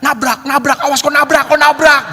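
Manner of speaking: high, rising panicked shout; alarmed yell. Retold or imitated. Passenger yelling a warning before the crash. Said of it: "Anton teriak".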